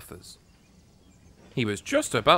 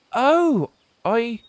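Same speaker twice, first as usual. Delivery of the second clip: sudden realization; 9 semitones higher, much slower than usual. Acted. Oliver realizes the mix-up.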